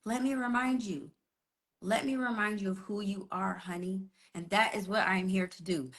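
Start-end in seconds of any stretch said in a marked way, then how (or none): 0.0-4.1 soft, tender fairy godmother; acted soothing, hushed and unhurried; gentle reassurance. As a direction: quietly comfort someone in tears. Godmother comforting crying Cinderella, acted.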